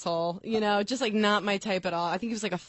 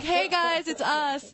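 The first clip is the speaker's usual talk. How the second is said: much higher, bright chirpy hello as a couple; mock couple greeting; 9 semitones higher, much slower than usual. Acting like a couple at the bar, chirping a much higher hello. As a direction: sing out a cheery couple's hello. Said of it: "walking around the bar, like".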